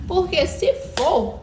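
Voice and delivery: loud, high, drawn-out protest; emphatic outburst. Real, not acted. Emphatic vow to withdraw if so.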